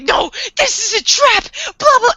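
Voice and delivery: high, hammed-up panicked protest; mock panic. Fan mocks Light denying everything, high panicked protest. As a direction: squeal it like a cornered villain.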